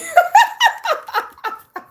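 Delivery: high, loud cackling run; real laughter. Real, not acted. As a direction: break up laughing, high and long. Laughs at belly remark.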